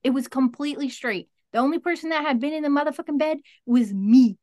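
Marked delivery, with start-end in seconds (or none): 1.5-4.4 worked-up realisation. Real, not acted. Realising nobody was there.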